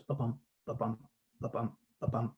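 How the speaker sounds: soft ba-bum, ba-bum with the weight on bum, like a heartbeat; heartbeat rhythm. Shown as a demonstration. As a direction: hum out a quiet heartbeat, light then heavy. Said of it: "like your rhythm of your heart".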